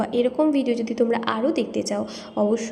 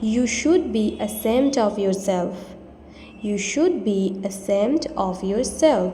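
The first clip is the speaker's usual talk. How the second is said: slow and clear; slow model sentence; much slower than usual. Shown as a demonstration. Teacher modelling the sentence slowly. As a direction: say it slowly for learners. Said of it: "তোমার নিজের লজ্জিত হওয়া উচিত".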